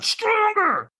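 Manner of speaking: very high, strained trainer's yell; imitated scream. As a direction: scream it at him like a fired-up trainer. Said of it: "he screamed at me".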